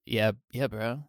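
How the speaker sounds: low laid-back bro voice; gym bro. Acted. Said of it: "like, by talking like a bro".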